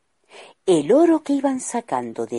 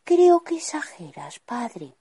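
put-on high airy whisper of a girl brushing him off; dismissive princess; 9 semitones higher than usual. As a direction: breathe it out high, shrugging off father's worry. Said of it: "Ella no le hacía mucho caso".

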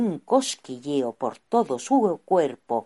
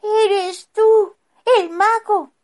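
put-on high child voice; 9 dB louder, slower than usual. Boy asks the wizard.

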